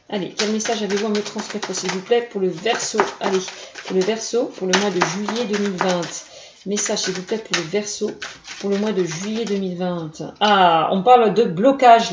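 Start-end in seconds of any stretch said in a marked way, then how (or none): 0.1-10.1 invocation douce. Acted. Invocation to the tarot cards.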